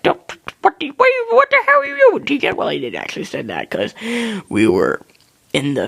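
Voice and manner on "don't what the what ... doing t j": very high mock-panicked dad; alarmed dad. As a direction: imitate dad freaking out in a squeal. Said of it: "Dad was like".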